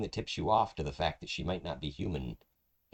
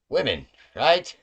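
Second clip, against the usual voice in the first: wry shrug; 10 semitones higher, 10 dB louder than usual. Acted. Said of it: "Jesh turns and he says".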